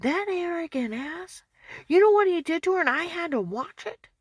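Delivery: high, loud furious rant; retold angry yelling. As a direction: rage at what he saw. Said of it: "He said".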